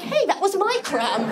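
high-pitched mock protest; rival ballerina. Second ballerina claiming her crumb, mock indignant. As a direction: object in a high put-on ballerina voice. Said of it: "the other ballerina comes in".

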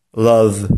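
"love" with a voiced V held at the end; correct love. Shown as a demonstration. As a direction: say "love" ending in V, not F. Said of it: "Prawidłowa wymowa tego słowa brzmi". (poprawna wymowa: "love" z dźwięcznym W na końcu)

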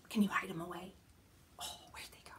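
hushed whisper of mock surprise; playful whisper. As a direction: whisper like it's a secret game. Hiding fingers again.

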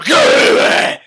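explosive garbled roar. Acted. Batman roaring at the Joker over gum, unintelligible. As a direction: bellow it as a shredded growl.

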